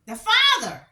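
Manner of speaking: sudden loud, high burst of anger; outraged outburst. Real, not acted. Commenting on the case, she erupts about the father with a loud outraged shout.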